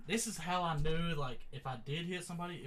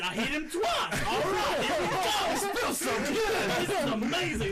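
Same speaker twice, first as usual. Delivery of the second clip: elated boasting; 10 semitones higher, 6 dB louder than usual. Retold or imitated. Fighter amazed his punches land, giddy and triumphant. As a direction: relive the thrill, laughing and loud. Retells elation at landing punches.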